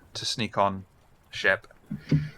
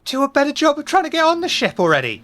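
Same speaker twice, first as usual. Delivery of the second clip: mock shrill retort; 7 dB louder than usual. GM mocking retort.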